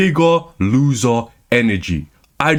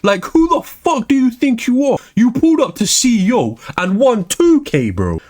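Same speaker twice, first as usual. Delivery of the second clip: angry rant; 9 semitones higher than usual. Real, not acted. Ranting at Arslan.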